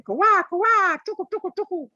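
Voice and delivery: very high squawking bird cries; bird calls. Retold or imitated. Teacher imitating birds calling during a lesson, very high. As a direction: chirp and caw like a bird. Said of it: "birds calling".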